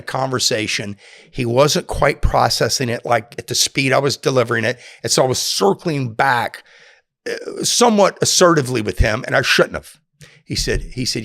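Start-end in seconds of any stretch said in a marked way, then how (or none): none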